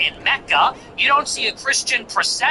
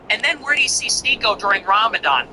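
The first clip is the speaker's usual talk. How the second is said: ordinary talk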